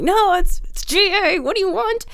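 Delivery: high-pitched impatient reply; strained politeness. Retold or imitated. Strained answer to pestering questions.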